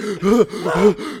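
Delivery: loud, high, hammed-up gasp for air; mock gasp. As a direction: gasp as if you ran to the gate. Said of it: "You get there all out of breath".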